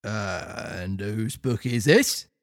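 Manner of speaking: officer interrogates Pinocchio slowly; slow low officer. Acted. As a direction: drag the question out like a stern copper.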